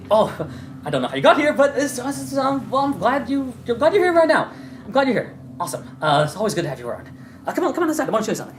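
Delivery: high, glad welcome; cheery NPC. Acted. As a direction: gush over the new arrival. NPC greets Bubbles warmly.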